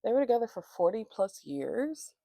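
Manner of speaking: ordinary delivery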